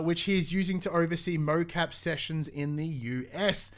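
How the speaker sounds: usual speaking voice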